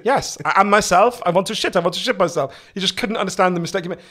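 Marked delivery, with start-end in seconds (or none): 0.0-2.6 put-on loud, insistent Germanic accent; imitating dad's Germanic accent, higher and louder; strong Germanic accent. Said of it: "very strong Germanic accent".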